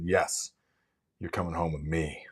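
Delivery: hushed, low triumph over the potatoes; a hushed, low, triumphant mock gloat; soft gloating whisper. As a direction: croon it low like a prize won. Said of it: "And I said it like that".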